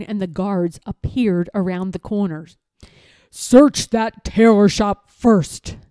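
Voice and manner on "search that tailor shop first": loud, slow command; old lord barking an order; commanding Lord Droon. A narrator's reading. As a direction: order the guards sharply. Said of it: "commanded Lord Droon".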